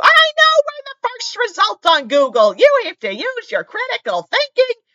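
very high, loud screeching impression; yelling lady. Animal control lady berating a caller, high screeched yell. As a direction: screech it slowly, word by word, in mock outrage. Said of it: "The lady was literally yelling".

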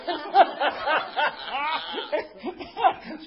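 a long high laugh rolling down. Real, not acted. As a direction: let the laughter run on. Laughs at women giggling.

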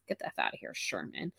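very low, hushed mock contempt; muttered dismissal. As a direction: mutter it way down low, under your breath. Mock dismissal of bar guy.

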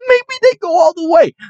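high, giddy take on the line; excited hype. Real, not acted. Fan repeating his playoff hope, giddy and high. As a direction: lift "Maybe they go all the way" up high, almost squealing.

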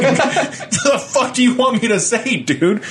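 half-laughing exasperation; laughing rant. Real, not acted. Ranting to his friend about morning small talk, laughing.

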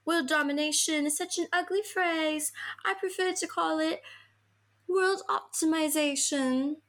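a hint of a high-voiced schemer; [name].

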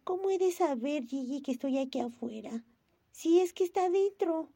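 a hint of a weary little girl; sighing. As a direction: sigh before speaking, resigned. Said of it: "dijo Momo con un suspiro".

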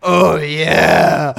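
loud breathy mock moan. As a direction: ham up a pleased groan. Mimicking pumping pleasure.

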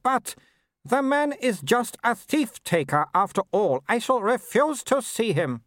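high, disdainful aristocratic lady; haughty Baroness. A narrator's reading. Mother scorning Vimes to her son, high haughty lady. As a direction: sniff down at everyone like a grand dame.

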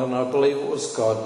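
ordinary delivery

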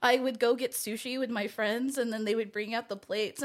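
normal talking voice

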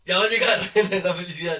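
loud, laughing mock announcement; mock-excited laughing. Teasing friends about happiness that will come, loud and laughing. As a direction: cackle it out as a loud joke.

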